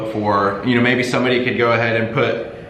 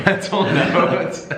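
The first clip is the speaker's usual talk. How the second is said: high, slow, drawn-out words through laughter; laughing; 9 semitones higher, much slower than usual. Real, not acted. Host shouldn't have had him go first, laughing. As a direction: stretch it out high while laughing. Joking repeat after regretting the order.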